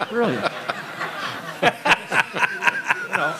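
laughing with delight. Real, not acted. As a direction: chuckle through the word. Laughs recalling the show.

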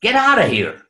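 doctor's urgent scream to get out; retold scream. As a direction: yell at the room to clear out. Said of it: "I screamed".

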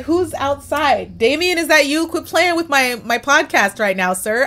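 high, loud call toward the door outside; calling out to someone outside, playfully accusing; mock call-out. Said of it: "I'm like".

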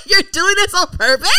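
real laughing, incredulous question; laughing accusation. Real, not acted. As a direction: crack up while accusing a friend. Laughing protest at teasing co-host.

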